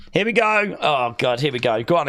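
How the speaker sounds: usual speaking voice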